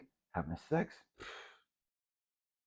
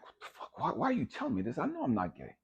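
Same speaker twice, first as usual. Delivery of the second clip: incredulous; 8 semitones higher than usual. Retold or imitated. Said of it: "And I'm like".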